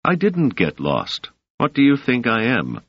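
mildly annoyed, pushing back; irritated. A narrator's reading. Selden to Doris, denying he got lost: mild irritation. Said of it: "suddenly irritated".

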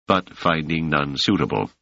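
ordinary delivery

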